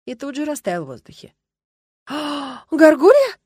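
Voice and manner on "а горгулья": high gasp bursting into a loud question; gasped surprise. A narrator's reading. Girl tugs the dragon's sleeve, gasping in surprise. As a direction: gasp it out in shock. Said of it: "ахнула и подёргала дракона за рукав".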